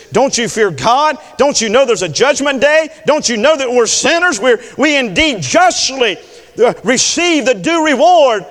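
high, loud, mounting sermon delivery; full-force preaching. Acted.

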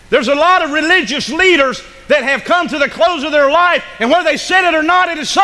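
high, loud preaching; preaching at full force. Acted. Preacher building to a climax before the congregation, at full force. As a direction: drive it home to the congregation.

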